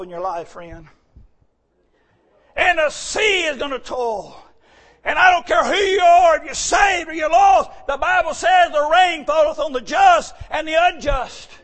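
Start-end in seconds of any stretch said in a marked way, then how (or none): 2.6-11.5 loud, high warning sermon; preaching at full force. Acted. Preacher shouting a warning to saved and lost. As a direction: cry it out to every sinner.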